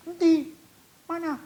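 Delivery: very high, incredulous asking; retold puzzled question. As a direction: imitate someone searching and not seeing. Others ask where the vision is.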